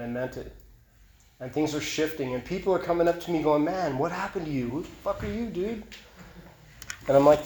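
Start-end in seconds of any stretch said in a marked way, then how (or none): 3.6-5.8 higher, softer voice of amazed onlookers; hushed, high-pitched mock disbelief; imitated amazement. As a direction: mimic someone baffled by a changed friend. Acting out people's amazed reactions.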